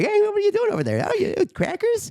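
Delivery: high mock host banter; Jay Leno voice. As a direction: ham up the host, teasing the guest.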